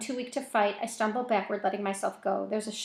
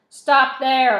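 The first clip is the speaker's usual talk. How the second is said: loud, raised order to stop; yelled command; 4 semitones higher, 14 dB louder than usual. A narrator's reading. Armed man calling from the darkness, yelling. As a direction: yell it as an order. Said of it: "a voice yells at us from the darkness".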